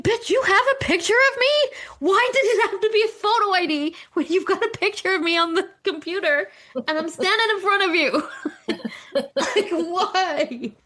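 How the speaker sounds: retelling an exasperated outburst at the clerk; incredulous rant. Retold or imitated. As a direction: go up high in mock outrage.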